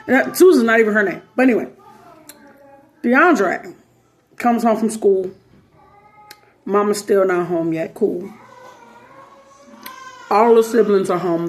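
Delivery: usual speaking voice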